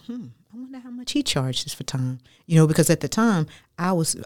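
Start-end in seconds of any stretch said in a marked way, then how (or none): 0.1-2.2 much higher and softer, a hushed musing aside; a quiet, high inner-thought voice; thinking aloud. Retold or imitated. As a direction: wonder it half to yourself, hushed. Retelling her own private thought.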